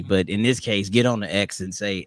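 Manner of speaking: ordinary talk, nothing marked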